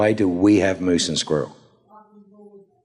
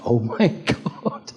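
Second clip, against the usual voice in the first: laughing gasp; 6 semitones higher than usual. Retold or imitated. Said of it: "Everyone just went like".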